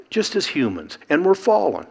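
normal talking voice

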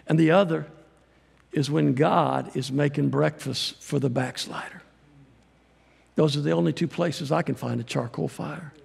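ordinary delivery

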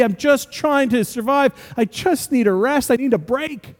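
high, fast-paced list of everyday stresses; frazzled rant. Acted. Preacher voicing an overwhelmed parent to the congregation, high and harried. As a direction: pile up the chores like someone at the end of their rope.

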